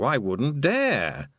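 slow deadpan I wouldn't dare; mock humble. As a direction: draw it out slyly. Toad teasing.

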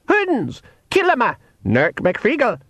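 high pixie battle cry. Acted.

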